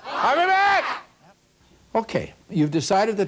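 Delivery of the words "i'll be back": exclaimed punchline. Acted. Punchline of customer's magic words.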